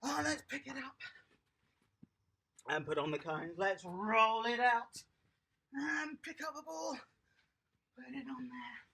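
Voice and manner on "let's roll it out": slow. Shown as a demonstration. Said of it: "coach demonstrates rolling".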